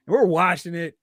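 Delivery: ordinary talk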